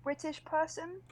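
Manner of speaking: high-pitched, halting British accent; British accent attempt. Shown as a demonstration. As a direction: put on a careful amateur British voice. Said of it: "What accents do you do successfully?".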